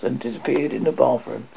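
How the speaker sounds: plain reading voice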